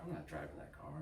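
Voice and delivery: low, soft Southern husband; imitating a quietly stubborn Southern man; Southern accent. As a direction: macho refusal in a light Southern accent. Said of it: "he had that southern accent too".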